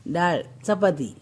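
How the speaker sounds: put-on डाळ चपाती drawn out slowly for the child to copy; slow coaching repeat. Shown as a demonstration. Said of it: "म्हणा".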